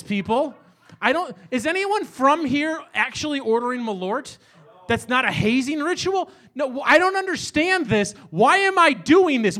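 exasperated rant. Real, not acted. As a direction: throw up your hands at the whole city. Ranting about Malort.

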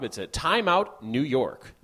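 slow recorded-announcer delivery; movie phone voice. Acted. Host plugging the editor's job, in a movie phone voice. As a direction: draw it out like a phone-line announcer. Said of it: "saying it in a movie phone voice".

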